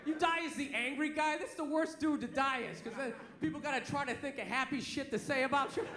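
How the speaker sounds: ordinary delivery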